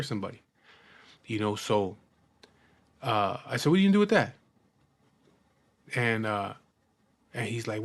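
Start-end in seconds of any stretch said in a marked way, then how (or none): 3.7-4.3 higher, louder challenge to the man with the gun; raised challenge. Retold or imitated. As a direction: call him out, voice up. Confronting man holding gun.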